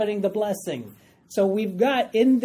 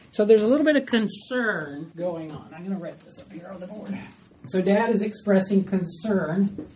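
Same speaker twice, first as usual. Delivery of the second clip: normal talking voice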